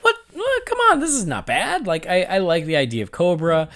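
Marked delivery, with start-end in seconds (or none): 0.0-1.9 high, delighted disbelief; surprised disbelief. Retold or imitated. To co-hosts, retelling her surprise while watching, in high delighted disbelief. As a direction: squeal a pleasantly surprised what. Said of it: "I was like".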